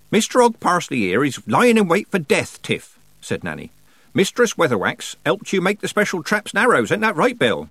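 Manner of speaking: high, projecting Nanny voice; knowing old witch. A narrator's reading. Nanny humours old man before the girl, knowingly. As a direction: explain for the old man to overhear.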